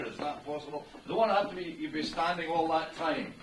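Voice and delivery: usual speaking voice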